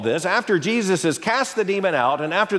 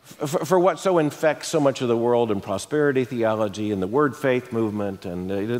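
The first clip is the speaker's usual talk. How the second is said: normal talking voice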